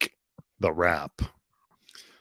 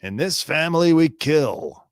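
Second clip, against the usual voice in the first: first take higher and louder, declaring the family creed; menacing family creed; 7 semitones higher, 3 dB louder than usual. Pretend. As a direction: announce it like a proud threat. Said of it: "Or was it all about Stephen Smith?".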